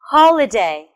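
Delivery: Holiday as one word, HO punched and falling away; stress on first syllable. Shown as a demonstration. Teacher modelling for learners to listen, stress on HO in Holiday. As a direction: hit the HO of Holiday. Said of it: "It's on the first syllable".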